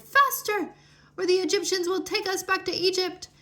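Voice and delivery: frantic cry. A narrator's reading. Fleeing Israelites fearing capture by the army, panicked high cry. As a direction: shout it while running for your life. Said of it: "they cried".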